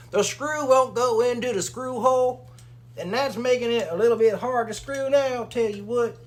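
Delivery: high, loud, goofy character voice; put-on silly voice. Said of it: "I don't know why I'm talking like that".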